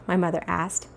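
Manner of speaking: ordinary delivery, nothing marked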